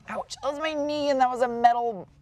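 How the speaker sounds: high, strained voice after a hard knock; in pain. Real, not acted. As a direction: talk through a real ouch. Just banged knee on metal.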